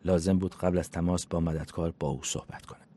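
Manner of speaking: usual speaking voice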